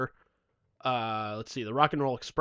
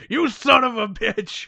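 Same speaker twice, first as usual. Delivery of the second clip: loud, high, drawn-out mock outrage; mock cursing out; 9 semitones higher, 8 dB louder, slower than usual. Imagining his friend cursing him out later, mock outraged. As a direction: curse him out in hammed-up fury. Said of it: "picture you cursing me out later".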